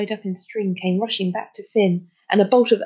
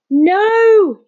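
high, loud cry of refusal; loud shout; 12 semitones higher, 9 dB louder than usual. A narrator's reading. Boy shouting out loud on his own, shouted. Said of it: "he shouted out loud".

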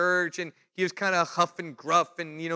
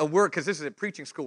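usual speaking voice

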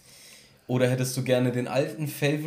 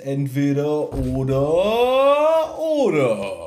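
langgezogen und laut angekündigt; Showansage; 7 dB louder, much slower than usual. Acted. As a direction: wie ein Moderator ausrufen. Kündigt seine Rubrik an. (langgezogene laute Ansage)

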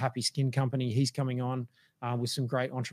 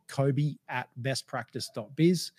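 slow, spelled-out email address; slow dictation; slower than usual. Shown as a demonstration. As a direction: read the address out slowly and clearly, with a rise at the end. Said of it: "that's my personal email address".

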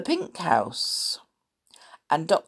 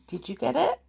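ordinary delivery

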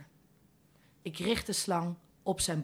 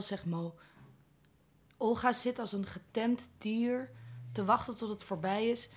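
ordinary delivery

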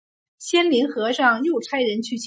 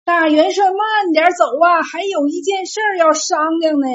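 loud call after someone; 6 semitones higher, 7 dB louder than usual. A narrator's reading. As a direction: shout to a rider up ahead. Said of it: "在后面大喊".

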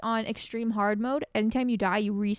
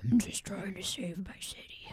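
mock gruff hero; 3 semitones lower, 6 dB quieter than usual. Mocking the actor's American accent with a whispered gravel voice. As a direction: growl out the line like a brooding vigilante. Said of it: "why does it have to go so low".

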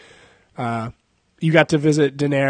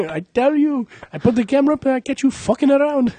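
much higher hammed-up accent; mock Indian accent; 11 semitones higher than usual. Comedian quotes the same character in a high put-on accent. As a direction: whine it like a cartoon shopkeeper.

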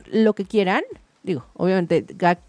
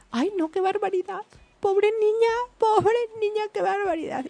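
put-on high, pitying old-lady voice; gossiping old lady; 11 semitones higher, 3 dB quieter than usual. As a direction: cluck like scandalized grandparents. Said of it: "y entonces decían". (señora escandalizada compadeciendo)

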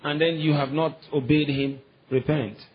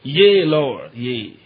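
King James response; 6 dB louder, much slower than usual. Shown as a demonstration. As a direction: give the old King James answer with force. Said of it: "the King James way of responding".

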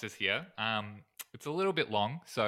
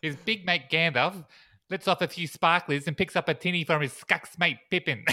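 raised, hammed-up Kiwi accent; bad New Zealand accent; 7 semitones higher, 5 dB louder than usual. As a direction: put on a broad, terrible New Zealand accent. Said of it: "I'll stop the terrible New Zealand thing".

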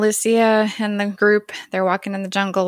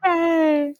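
happy cheer; 10 semitones higher than usual. Real, not acted.

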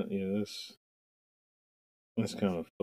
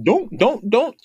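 high, loud stuck-brain repetition; brain malfunctioning; 12 semitones higher, 6 dB louder, slower than usual. Acted. Mocking how basketball players' brains go, malfunctioning. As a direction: mimic a brain glitching on one word.

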